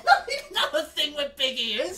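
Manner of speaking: loud, high, slow laughing protest; laughing disbelief. Real, not acted. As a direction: protest incredulously through laughter. Laughing disbelief at a silly claim.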